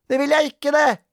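very high, loud comeback; stung, protesting cry; indignant denial. A narrator's reading. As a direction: squabble like a child. Sara contradicts in quarrel.

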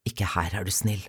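low, softened voice; anxious hushed plea. A narrator's reading. Father afraid of being overheard, anxious and hushed. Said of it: "Han kastet et engstelig blikk rundt seg".

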